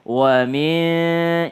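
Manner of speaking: WA MIN unhurried, both sounds given full length; relaxed. Shown as a demonstration. Teacher modelling wa min, relaxed and drawn out. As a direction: stretch WA MIN at an easy pace. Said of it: "Berikan haknya ya".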